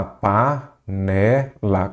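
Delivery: Panela drawn out very slowly, syllable by syllable; slow syllable demo. Shown as a demonstration. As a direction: spell out Panela slowly so the stressed syllable can be heard. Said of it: "Qual que é a sílaba tônica?".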